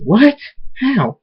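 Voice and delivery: high-pitched startled questions; shocked. A narrator's reading. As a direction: react with alarm, voice jumping up. Shocked by the escape news.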